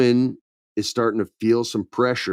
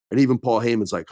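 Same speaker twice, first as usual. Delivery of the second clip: usual speaking voice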